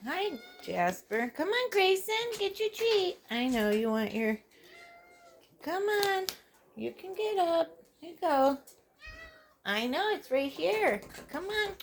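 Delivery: high coaxing talk to a pet. Real, not acted. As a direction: coax the cat up, high and sweet.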